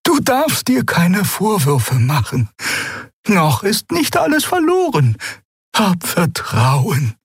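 high, slow soothing figure voice; gentle old Xea. A narrator's reading. Xea consoles Keru.

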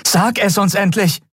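high, urgent plea for answers; desperate demand. A narrator's reading. Endriel demands that Kai finally talk, desperate. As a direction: press him in despair. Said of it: "Sie hörte die Verzweiflung ihrer eigenen Stimme".

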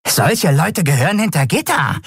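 high-pitched skeleton voice; hurried creature voice. Acted. Skeleton to the lieutenant over a bribe, eager creature voice. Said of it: "beeilte sich das Gerippe zu sagen".

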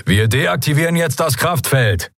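high, official-sounding announcement; commander's announcement. A narrator's reading. Commander tells prisoners the field is dropping, high authoritative announcement. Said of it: "kündigte der Kommandant an".

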